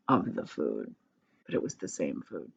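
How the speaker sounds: low, quiet deadpan about the same food; deep gloomy voice. Acted. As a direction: drop way down, like a sad joke. Dreary family meals described.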